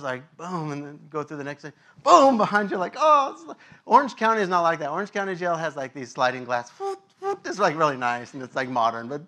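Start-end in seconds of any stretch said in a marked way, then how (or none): 2.0-3.3 loud boom then a startled oh; gate slam and gasp. Retold or imitated. 6.7-7.4 high imitated whoop of sliding doors; sliding door whoosh. Sliding glass doors sound.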